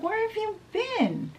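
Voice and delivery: high-pitched mock-frantic question; frantic nurse. Nurse finds the missing princess, high frantic voice. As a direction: cry it out high, like a worried nurse.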